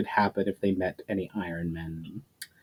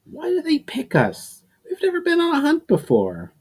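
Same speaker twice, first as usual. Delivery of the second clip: put-on much higher, nervous boy voice; high anxious young voice. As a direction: squeak it out like a scared kid. Nervous young hunter's question.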